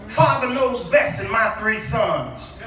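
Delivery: ordinary delivery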